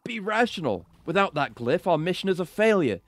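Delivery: high, urgent put-on voice; pleading female character.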